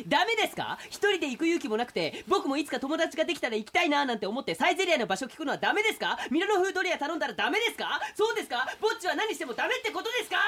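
mock sudden outburst. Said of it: "そんな急に切れんなよ".

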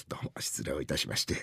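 low, whispered, sheepish apology; hushed apology. Acted. Father excuses his blunder, sheepish and hushed. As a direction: apologise under your breath. Embarrassed apology after a mistake.

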